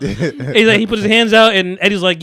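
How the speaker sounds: ordinary talk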